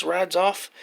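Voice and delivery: normal talking voice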